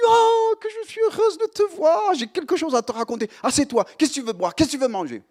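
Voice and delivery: high, gushing happy aunt voice; delighted aunt. Retold or imitated. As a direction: welcome him in like an overjoyed aunt. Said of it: "elle me dit".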